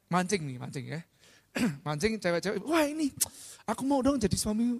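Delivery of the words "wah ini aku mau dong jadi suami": high-pitched mock swooning; gushing admirer. Speaker mimicking girls hooked by sweet posts, high gushing voice. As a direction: imitate a smitten admirer, pitched up.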